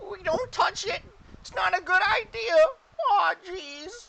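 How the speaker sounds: very high, loud goblin whine; goblin chorus. Acted. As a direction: squeal it like a fretting goblin. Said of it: "some of the goblins turn to you and go".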